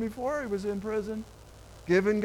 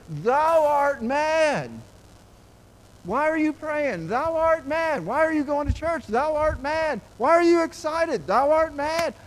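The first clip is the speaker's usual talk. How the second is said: louder and higher, mocking accusation; scoffing mockery; 6 semitones higher, 5 dB louder than usual. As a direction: throw the taunt at a believer. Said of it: "people look at us and say".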